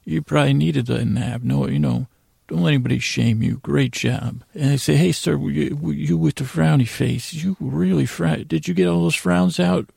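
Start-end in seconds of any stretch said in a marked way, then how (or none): none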